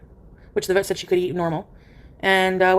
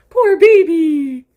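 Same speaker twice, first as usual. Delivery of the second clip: wailing pity; 8 semitones higher, 9 dB louder, much slower than usual. Real, not acted. Owner wailing loud mock pity at her cat. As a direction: wail it in mock pity, slowly.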